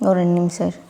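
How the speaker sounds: low, quiet, close to tears; subdued and tearful; real tearfulness. Real, not acted. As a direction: ask for a moment, softly, through tears. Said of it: "இப்ப எதுக்கு அழுகுற?".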